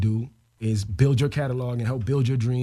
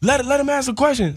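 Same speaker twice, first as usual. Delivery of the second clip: much higher, loud yelling demand; screaming from the back; 13 semitones higher, 4 dB louder than usual. Retold or imitated. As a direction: shout it across the room. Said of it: "My dog starts screaming in the back".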